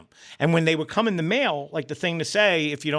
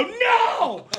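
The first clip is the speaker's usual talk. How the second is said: high, drawn-out "no" yelled out; loud storytelling cry of refusal; retold horrified shout; 3 dB louder than usual. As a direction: relive the horror in a high yell. Retold horrified reaction to the sight.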